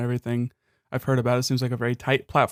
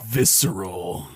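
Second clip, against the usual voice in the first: drawn out, lower and slow; slow deep echo; 5 semitones lower, much slower than usual. Pretend. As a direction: echo the word slowly and deep. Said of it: "A little more real".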